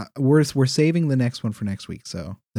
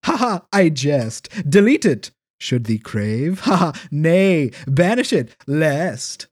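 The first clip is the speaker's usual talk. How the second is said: hammy stage actor; 2 semitones higher, slower than usual. Acted. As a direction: ham it up like a stage player. Archaic email read theatrically.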